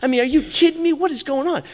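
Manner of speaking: mock the envious sibling griping over the food; a brother who thinks he got shortchanged, whining about it; grumbling brother. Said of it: "No, like".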